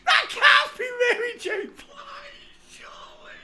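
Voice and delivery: high, loud, disbelieving laughter; shocked laughing shout. Real, not acted. Gamer reacting to a shocking search result, laughing disbelief. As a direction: shriek it out, unable to believe the picture.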